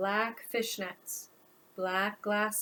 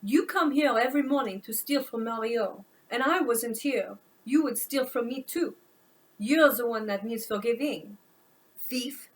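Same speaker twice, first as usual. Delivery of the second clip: put-on French accent; 5 dB louder than usual. French woman accuses Hank.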